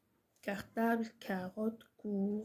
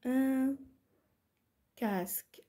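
word-by-word dictation; 3 semitones higher, much slower than usual. Shown as a demonstration. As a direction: split with a long pause after ان. (إملاء متقطع كلمة بكلمة)

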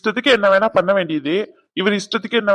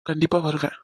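ordinary talk, nothing marked

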